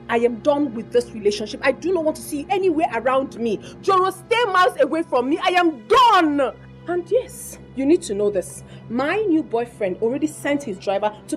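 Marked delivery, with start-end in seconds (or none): 3.8-6.5 angry final warning. Acted. Girlfriend ending the relationship for good, loud angry outburst. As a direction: build to a loud, stretched last word.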